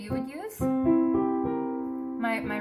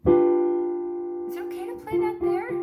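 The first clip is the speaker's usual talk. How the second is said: high questioning; 11 semitones higher, 7 dB louder, slower than usual. Real, not acted. Asks herself at piano.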